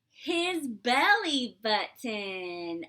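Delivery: loud gasp then slow, loud reveal. Acted. Teacher revealing Pete's belly button to the children. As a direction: build suspense, then unveil slowly.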